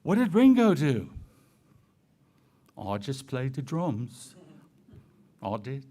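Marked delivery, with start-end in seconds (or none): 2.8-5.8 low, soft, badly faked Liverpool accent; hammy attempt at a Liverpool accent. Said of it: "That's my very bad Liverpool accent".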